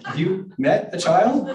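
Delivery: high, loud, slow comeback; exaggerated incredulous question; incredulous retort. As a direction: stretch it out in mock disbelief. Author's retort to publisher, retold.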